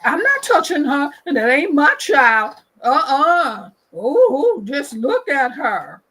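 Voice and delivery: a hint of an indignant onlooker backing off; bystander refusing to help.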